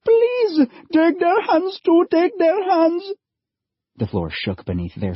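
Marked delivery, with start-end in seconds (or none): 0.0-3.0 much higher, louder begging mother; calling out. A narrator's reading. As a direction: call out to save her sons. Mother begs for boys.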